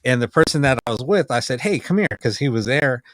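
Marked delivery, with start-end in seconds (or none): 1.6-2.1 higher-pitched retold call; quick beckoning, pitched up; calling over. Retells calling companion over.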